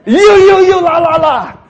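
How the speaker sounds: hammed-up Singlish finger-pointing; Singaporean accent. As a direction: shout you at them like a Singlish uncle. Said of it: "If he was Singaporean".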